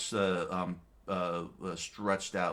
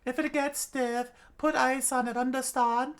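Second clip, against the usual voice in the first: put-on old witch voice; 6 dB louder than usual. A narrator's reading. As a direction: talk like a sly old witch doctor.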